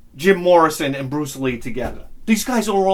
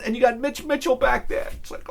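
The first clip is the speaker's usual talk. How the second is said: ordinary delivery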